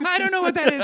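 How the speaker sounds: high comic interviewee; high-pitched street character; man on the street. Pretend. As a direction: plead ignorance in a high put-on voice. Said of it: "I'm the man on the street".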